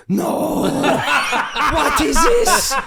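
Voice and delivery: put-on high, very slow Italian-accented disbelief; Paolo Di Canio. Italian manager reading the scales, slow Italian disbelief. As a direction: drag out the outrage in an Italian accent. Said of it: "he's went".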